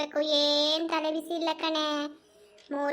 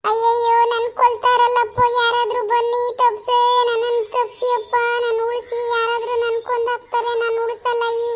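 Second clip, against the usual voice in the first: loud, higher panicked cries; wailing for help; 5 semitones higher, 7 dB louder than usual. Acted. Wife pulled by the hair, wailing loudly for someone to save her. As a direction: scream to the neighbours for rescue.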